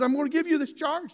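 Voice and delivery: loud, high-pitched solemn order; urgent charge. Acted. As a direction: charge them forcefully. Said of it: "Paul was using that kind of thing".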